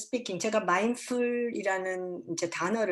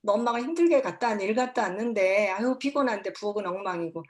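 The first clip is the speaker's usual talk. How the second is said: mother scolding over the messy kitchen, louder; raw irritated mom; 4 dB louder than usual. Shown as a demonstration. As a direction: let the raw annoyance spill out. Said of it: "날 감정이 그대로 나와요".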